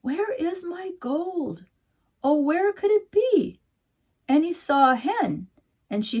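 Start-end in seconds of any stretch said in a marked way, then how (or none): 0.0-3.6 much higher, slow fretful leprechaun voice; a little man fussing over his gold; mumbling leprechaun. A narrator's reading. Leprechaun mumbles to himself in the courtyard, high and fretful. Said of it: "he mumbled".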